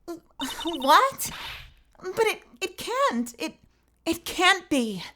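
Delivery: high, slow, shaken denial; disbelieving witness. Acted. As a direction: refuse to believe it in a high voice.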